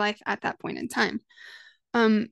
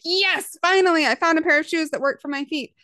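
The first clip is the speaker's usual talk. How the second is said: thrilled, high and loud; excited; 6 semitones higher, 5 dB louder than usual. Retold or imitated. Shopper finding shoes that finally fit, excited and loud. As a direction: burst out with joy at last.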